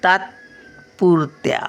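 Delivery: तात्पुरत्या stretched out slowly, half त; slow careful syllables. Shown as a demonstration. Grandmother reading to children, slowly. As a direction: spell out तात्पुरत्या by ear. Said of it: "हे बघा, तचा इथे तातमध्ये येतो".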